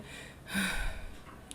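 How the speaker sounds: open, breath-only exhale; demonstrated sigh. Shown as a demonstration. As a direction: sigh out to find the voice. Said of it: "You hear that".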